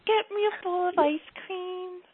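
sweet asking. Shown as a demonstration. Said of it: "the way you're supposed to say it".

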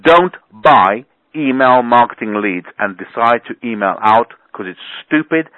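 usual speaking voice

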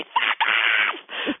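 drawn-out mock shout; comic cursing outburst; imitated outburst. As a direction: blurt it as a hammed-up yell. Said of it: "the odd time she'll say".

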